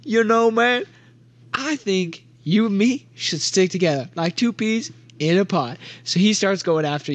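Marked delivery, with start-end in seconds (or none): 0.0-5.8 chummy oddball, pitched up at first; Big Tim. Acted. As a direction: put on a clingy new-friend voice. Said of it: "and Big Tim goes".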